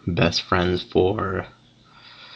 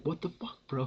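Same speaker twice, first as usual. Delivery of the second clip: high mock yell, held back in volume; retold scream; 8 semitones higher, 11 dB quieter than usual. Friend reacting to the hit, restrained high mock scream. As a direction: imitate a shocked yell without the volume. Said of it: "anyways, he screams".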